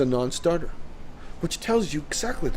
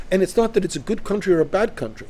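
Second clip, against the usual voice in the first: ordinary delivery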